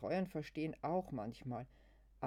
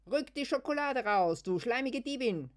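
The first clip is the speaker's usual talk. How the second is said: much higher, louder put-on screech; mock insult shout; 10 semitones higher, 11 dB louder than usual. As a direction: hurl it back in play. Gespielter Schimpfstreit. (gespieltes lautes Schimpfen)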